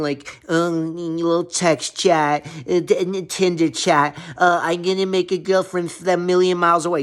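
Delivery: mocking voice for text-chat guys. Mocking men who date by chat, in a sneering voice. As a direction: sneer at app daters.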